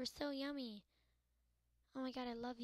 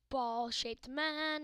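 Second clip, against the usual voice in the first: a hint of a dramatic announcer, slowed right down and louder; mock hero voice; 3 semitones higher, 8 dB louder, much slower than usual. Gamer naming the ball hero in a hammed-up voice. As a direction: draw it out like a movie title. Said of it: "So he's like".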